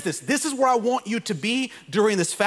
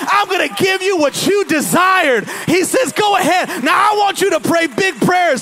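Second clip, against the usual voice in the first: full-force preaching; 9 semitones higher, 5 dB louder than usual. Acted. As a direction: preach it to the rafters.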